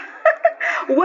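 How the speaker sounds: high giddy giggle of reunion; giddy laugh. Acted. Friend greeting old friend with a giddy laugh.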